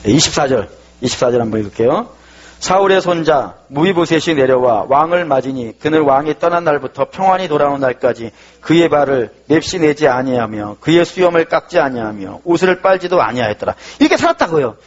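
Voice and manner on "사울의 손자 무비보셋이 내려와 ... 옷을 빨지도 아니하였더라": 성경 구절을 천천히 또박또박 낭독; scripture reading. Acted. Reads scripture aloud. (천천히 낭독하듯)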